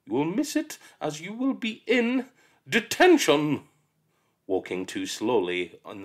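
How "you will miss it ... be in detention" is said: smug teacher. Acted. Miss Seeth tells the late child, smugly. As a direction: deliver it with relish.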